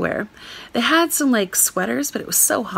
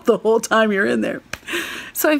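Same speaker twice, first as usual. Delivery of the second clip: ordinary delivery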